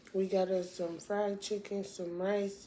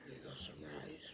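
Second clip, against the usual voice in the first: whispering; 13 dB quieter than usual. Real, not acted. Mukbang, taking a bite.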